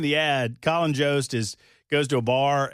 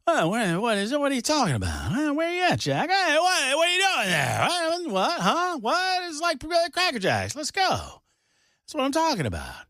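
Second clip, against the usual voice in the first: high, excited old-guy impression, voice pitched way up; Dana Carvey bit; 11 semitones higher than usual. Host doing a Dana Carvey bit for his co-host, high squeaky impression. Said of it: "Dana Carvey".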